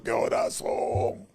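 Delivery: breathy, slow ghostly whisper; whispered rasp. Retold or imitated. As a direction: breathe it like a corpse. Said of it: "me ha dicho".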